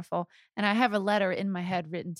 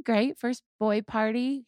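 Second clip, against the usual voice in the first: ordinary delivery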